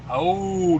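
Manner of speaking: ordinary talk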